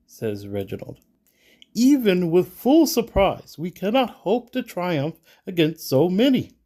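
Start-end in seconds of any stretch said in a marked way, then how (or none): 1.7-6.5 loud, high, emphatic warning; insistent. A narrator's reading. Reginald warning against facing so many, insistent. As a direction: argue it forcefully, voice pushed up.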